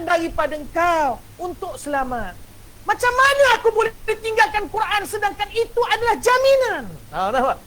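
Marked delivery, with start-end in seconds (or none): 2.8-6.8 pleading servant. Shown as a demonstration.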